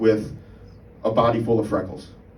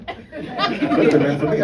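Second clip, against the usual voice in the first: usual speaking voice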